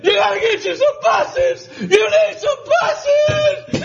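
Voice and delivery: high, shouted plea, rising to a squeal; excited shout. Retold or imitated. Old trucker shouting at a passing pastor. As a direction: grab him and yell it in his face. Charlie grabbing preacher, shouting.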